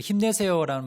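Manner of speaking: 힘내세요 with a loud, lifted cheer. Shown as a demonstration. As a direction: shout it like a pep cheer. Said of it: "들으면 좀 힘이 나지요".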